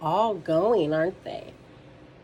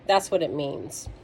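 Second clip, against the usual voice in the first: ordinary delivery, nothing marked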